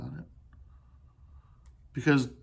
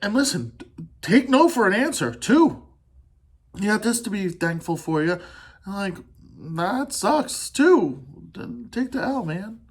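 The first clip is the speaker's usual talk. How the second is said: fired-up advice; 7 semitones higher, 4 dB louder than usual. Real, not acted.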